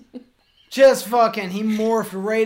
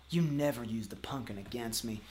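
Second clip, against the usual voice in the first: much lower, softer broken plea; weak defeated voice; 7 semitones lower, 10 dB quieter than usual. Acted. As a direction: say it beaten and spent. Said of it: "which means".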